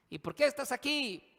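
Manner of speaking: high-pitched, insistent questioner; prisoner interrogating. Acted. Inmates asking each other.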